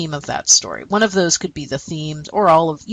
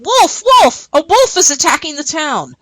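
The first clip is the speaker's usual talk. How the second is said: high-pitched cry of wolf; acted shout of alarm, pitched way up; 9 semitones higher, 4 dB louder than usual. Boy fooling a sheep herder, shouting a false alarm. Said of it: "ran up to a sheep herder and shouted".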